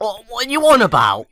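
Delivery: high, loud caricature British; mock-indignant British outburst; British accent. Continuing British impressions for friends, caricature British accent.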